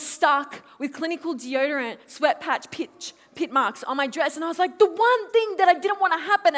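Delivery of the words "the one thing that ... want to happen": loud, high exasperated wail; acted-out dismay, voice pushed up; exasperated outburst. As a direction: complain as if disaster struck. Recounting her panic, acted.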